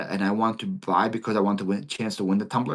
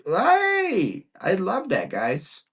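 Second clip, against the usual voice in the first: delighted cry pitched much higher; excited hey; 10 semitones higher, much slower than usual. Real, not acted. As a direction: burst out happily, voice jumping way up. Host thrilled by charity news.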